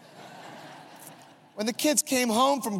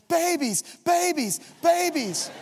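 imitating a frantic stranger's cry; mock scream; 6 semitones higher, slower than usual. Stranger yells at crash scene, mock frantic scream. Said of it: "She starts screaming".

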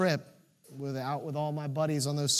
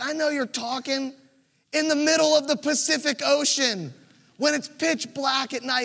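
angry retort; 7 semitones higher, 4 dB louder than usual. Retold or imitated. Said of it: "He's like".

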